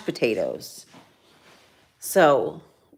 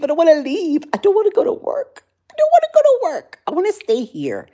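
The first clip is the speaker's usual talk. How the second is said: building high whine, faster and faster; whiny; 10 semitones higher, 3 dB louder, faster than usual. Acted. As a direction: whine like a kid who won't go. Whiny complaint, rising.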